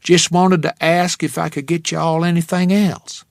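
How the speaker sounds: put-on thick Texas accent, friendly waitress. A narrator's reading. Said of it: "in a Texas accent that was thick enough to cut".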